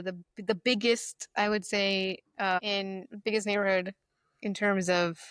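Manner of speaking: ordinary talk, nothing marked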